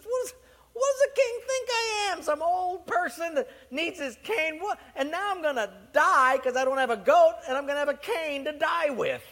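high, loud, outraged whine; indignant complaining. Acted. Livingstone griping to God about a cane, high indignant whine. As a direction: play the wronged missionary griping to God.

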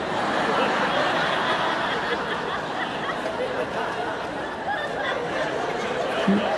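long high breathy laugh. Real, not acted. Preacher laughing at his own punchline, long and high. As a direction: laugh at your own joke.

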